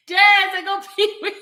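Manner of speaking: usual speaking voice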